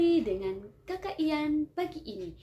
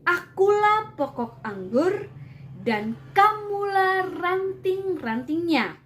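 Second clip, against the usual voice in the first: scripture reading; 6 dB louder than usual. Acted. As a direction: proclaim the verses to the room. Reads the Bible passage aloud.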